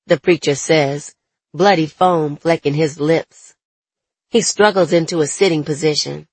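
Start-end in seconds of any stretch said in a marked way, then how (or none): none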